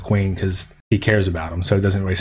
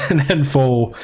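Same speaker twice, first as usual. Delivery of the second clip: real laugh breaking in, higher and slow; 4 semitones higher, slower than usual. Real, not acted. Reviewer amused by Foal's misunderstanding, laughing. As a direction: get the words out through laughter.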